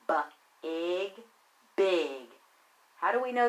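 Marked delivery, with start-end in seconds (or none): 0.1-1.2 B-ig split into its sounds, b then ig; sounded out. Shown as a demonstration. Teacher sounding out B-ig for young readers. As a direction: tap out B-ig sound by sound. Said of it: "we can tap it out".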